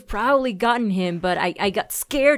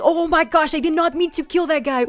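startled; 6 semitones higher, 3 dB louder than usual. Real, not acted. Accidentally killed player.

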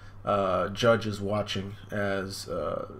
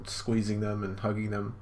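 ordinary talk

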